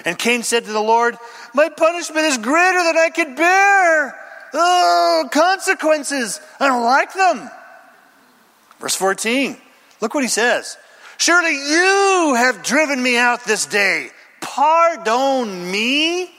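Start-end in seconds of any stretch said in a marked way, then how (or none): 1.5-7.5 whiny complaint. Acted. Cain complains to God. 11.2-16.3 mock self-pity. Cain whining to God.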